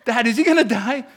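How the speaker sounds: put-on high, loud nagging kid question; a kid pestering her dad mid-movie; imitating daughter. Daughter pestering Dad during Braveheart, high and insistent. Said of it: "She's always trying to ruin the movie".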